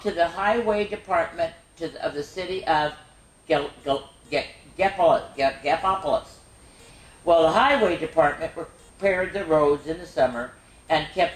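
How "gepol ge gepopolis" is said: usual speaking voice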